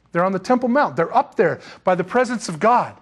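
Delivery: ordinary talk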